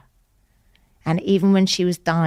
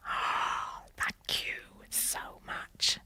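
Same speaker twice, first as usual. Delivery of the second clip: slow, hushed whisper, much softer; whispered thanks; 14 dB quieter, much slower than usual. Retold or imitated. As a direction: breathe the thanks out slowly. Grateful reply to sister.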